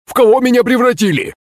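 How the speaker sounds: high, frantic questioning; alarmed frog cry. A narrator's reading. Man just turned into a frog, alarmed cry. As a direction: cry out high, demanding answers. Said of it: "закричала лягушка голосом слуги".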